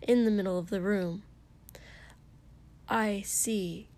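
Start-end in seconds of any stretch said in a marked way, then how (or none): 2.9-3.9 mildly stiff acknowledgement; a touch of stiffness, understated. A narrator's reading. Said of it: "Ida said stiffly".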